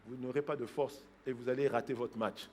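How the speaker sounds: ordinary talk, nothing marked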